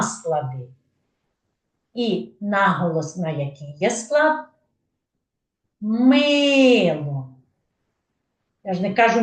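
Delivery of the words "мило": "Мило" with long, emphatic Ми; stress on first syllable. Shown as a demonstration. Teacher asking where stress falls, Ми in "Мило" stressed. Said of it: "виділяю голосом перший склад".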